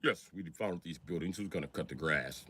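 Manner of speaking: very low, soft boss voice; straight-laced boss. Acted. Boss asks who will mow the ruins, low soft voice. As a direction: drop low and quiet, like a formal employer. Boss role in acted skit.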